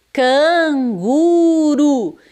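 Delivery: "canguru" bem esticado, R de um toque leve; R brando. Shown as a demonstration. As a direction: alongue "canguru" sílaba por sílaba, com R fraquinho. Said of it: "É o R brando". (erre brando em "canguru", bem devagar)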